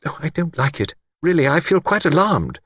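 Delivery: high, fluttering alarm; mild alarmed spinster; alarmed exclamation. A narrator's reading. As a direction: cry out her worry. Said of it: "Miss Wainfleet exclaimed".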